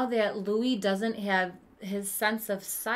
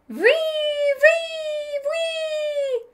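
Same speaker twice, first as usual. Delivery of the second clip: much higher sung wee notes; whistle imitation; 12 dB louder, much slower than usual. Boy imitating the whistle his brother taught him, high.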